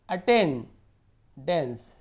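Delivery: "Attendance" very slowly, pausing between attain and dense; broken-down word. Shown as a demonstration. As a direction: sound out "attendance" in two separate chunks. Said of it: "dense को अलग से पढ़ सकते हो".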